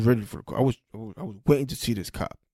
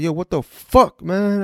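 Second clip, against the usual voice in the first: higher, louder retold exasperation; cursing outburst; 6 semitones higher, 4 dB louder, slower than usual. Driver cursing in front of a cool cop, exasperated. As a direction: swear in indignant disbelief. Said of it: "I just started cursing".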